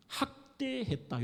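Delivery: usual speaking voice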